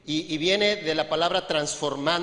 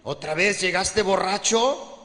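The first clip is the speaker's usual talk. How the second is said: scolding wife; 4 dB louder, slower than usual. Acted. Wife scolds drunk husband.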